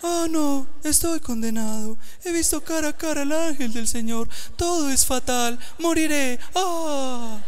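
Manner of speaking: high, whiny hopeless moaning; Tristeza. Retold or imitated. Gideon sounding like Sadness from Inside Out, high and moaning. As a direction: mope like the gloomiest cartoon character. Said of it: "se parece como a Tristeza de Intensamente".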